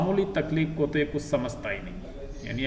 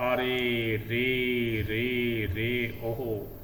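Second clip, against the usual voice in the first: slow drawn-out groan of pain, acted; groaning cry; 3 semitones lower, much slower than usual. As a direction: stretch out the aah like a boy in pain. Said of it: "तेजी से वो चिल्लाता है जोर जोर से". (पेट दर्द से कराहता बच्चा, धीमी खिंची हुई कराह)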